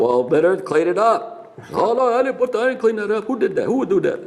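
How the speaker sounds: high Virginia-accented mock outrage; deep Virginia accent. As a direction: imitate an indignant Virginian protesting, pitch way up. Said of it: "He's from Virginia with that deep Virginia accent".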